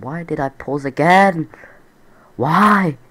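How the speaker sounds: exasperated outburst at own mistake. Real, not acted. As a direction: cry out in frustration at yourself. Exasperated at own forgotten pause.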